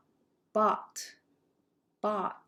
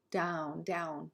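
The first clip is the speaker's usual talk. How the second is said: ordinary talk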